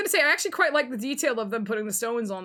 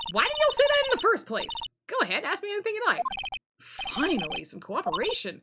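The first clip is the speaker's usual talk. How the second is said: friendlier Southern woman; 11 semitones higher than usual. Acted. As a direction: invite them over in a Southern accent. Voices Southern character, now cooperative.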